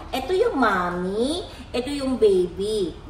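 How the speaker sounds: put-on slow, sing-song teaching to a baby. Shown as a demonstration. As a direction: spell it out slowly for the little one. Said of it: "makinig ka sa nanay mo". (mabagal na pagtuturo sa bata)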